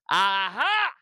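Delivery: gleeful triumphant shout; triumphant aha. Real, not acted. Host cheers the athlete's comeback, triumphant. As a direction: crow it like you won.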